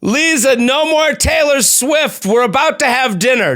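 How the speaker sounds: high, loud, slow yelling mom; mock parent shout. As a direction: shout at her like a strict parent. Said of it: "Do that in Russian".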